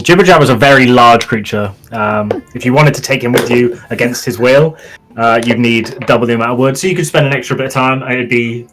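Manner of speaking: ordinary delivery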